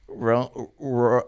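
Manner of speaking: stuck on the first sound, imitating a struggling reader; poor reader. Stoned student starting Romeo and Juliet aloud, stumbling. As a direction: stumble into the word as a bad reader. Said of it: "he was not a good reader".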